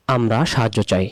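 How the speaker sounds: ordinary talk, nothing marked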